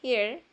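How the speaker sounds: "here" with the final E left silent. Shown as a demonstration. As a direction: model "here" as one syllable, ending on R. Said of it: "E muda". ("here" con la E final muda)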